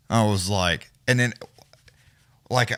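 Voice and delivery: ordinary delivery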